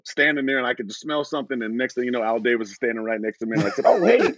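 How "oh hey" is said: high, loud nervous hello to the boss; starstruck greeting. Retold or imitated. Rookie meeting the team owner, nervous excited greeting.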